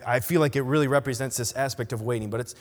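ordinary talk, nothing marked

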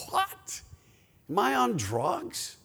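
high, incredulous squeak, kept soft; mock disbelief. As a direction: act stunned by the accusation, voice way up. Acted disbelief at God's accusation.

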